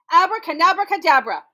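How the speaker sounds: loud, high magic command; loud spell. Acted. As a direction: call the stick down loudly. Anansi stops the stick.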